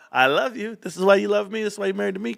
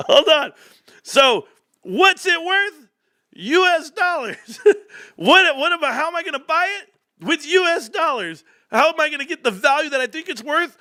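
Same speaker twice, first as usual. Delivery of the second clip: incredulous outburst; 11 semitones higher than usual. Real, not acted. Ranting to viewers about crypto, cracking up in disbelief. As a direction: sell how absurd it is.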